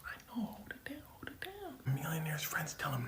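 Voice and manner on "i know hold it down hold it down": high urgent whispered shush; hushed whisper. Retold or imitated. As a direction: keep it down, high and secret. Hushing friends near his idol.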